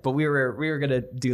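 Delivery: ordinary delivery, nothing marked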